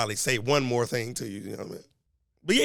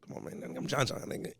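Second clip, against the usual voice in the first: hushed brush-off; 9 dB quieter than usual. Retold or imitated. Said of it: "I'm like".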